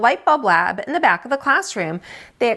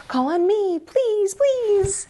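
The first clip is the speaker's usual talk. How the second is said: much higher, pleading please please; eager begging; 11 semitones higher, slower than usual. Acted. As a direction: bounce in your seat begging. Lily begging to be called.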